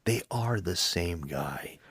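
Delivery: slow dramatic whisper. Retold or imitated. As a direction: breathe it out slowly, awestruck. Cop's big realization.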